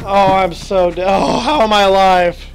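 normal talking voice

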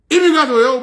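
loud and high, spitting the word out; loud exclamation. Real, not acted. Scoffing at people who want residency, loud and high. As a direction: bark the word at them. Mocking talk of immigration residency.

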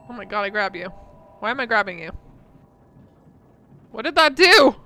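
loud panicked shouts; panicked yelling. Real, not acted. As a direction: yell in alarm at the screen. Panicked reaction to game.

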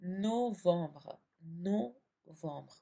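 नवंबर very slow, no-vombr with a nasal om; French novembre. Shown as a demonstration. Teacher drawing out novembre for students to copy. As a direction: stretch out नवंबर syllable by syllable, the French way. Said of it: "French में इसे कहते हैं".

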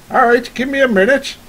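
loud, high holler from inside; calling out. Acted. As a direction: shout back to whoever is knocking. Farmer calls from behind the door.

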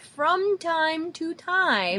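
high, very slow, drawn-out hinting; woman asking a favor. Acted. Boss hinting at a secret task, high and drawn out. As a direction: stretch it out as a loaded hint. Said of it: "the woman is like".

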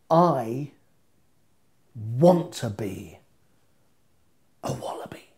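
slow, hushed whisper. Acted. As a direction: breathe it out secretly. Dramatic finish, whisper.

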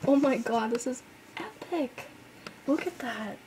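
usual speaking voice

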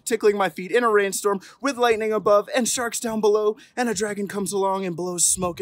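rising storybook drama; building excitement. A narrator's reading. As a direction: stack the dangers with gusto. Escalating cumulative refusal.

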